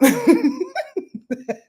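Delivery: laughing at her own aside; high burst of laughter. Real, not acted. Astrologer laughing at herself mid-livestream, high laugh.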